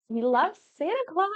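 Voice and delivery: high-pitched mock-childlike cheer; playful cheer. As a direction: cheer it like an excited kid, rising up. Cheering childhood love of Christmas.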